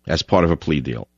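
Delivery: usual speaking voice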